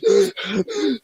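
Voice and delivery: high, sudden gasp of shock; sharp gasp. Retold or imitated. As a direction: catch your breath in panic. Bleeding in the bushes, reliving it.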